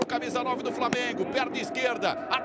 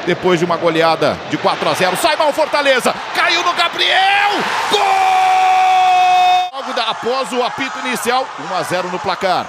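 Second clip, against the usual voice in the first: loud, fast goal commentary; live goal call; 11 dB louder than usual. Real, not acted. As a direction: race to the goal.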